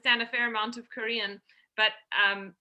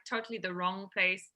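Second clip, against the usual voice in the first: usual speaking voice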